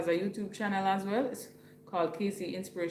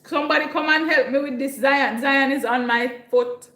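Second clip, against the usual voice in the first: loud, raised call to the household; calling for help; 6 semitones higher, 8 dB louder than usual. Real, not acted. Streamer calling someone to take Zion off her foot, loud plea. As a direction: call out for someone to come over.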